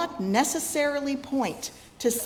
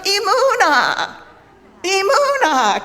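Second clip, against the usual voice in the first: loud braying; 9 semitones higher, 9 dB louder than usual. Shown as a demonstration. Preacher leading the congregation, loud braying cries. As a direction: bray Emunah out like a baby donkey. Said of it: "Can you say it? Say it loud and bray".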